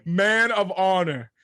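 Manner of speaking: loud, high, drawn-out proclamation; stretched-out pledge of honor; mock-solemn declaration. Real, not acted. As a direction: declare it slowly and loudly, fighting laughter. Regaining composure after laughing fit.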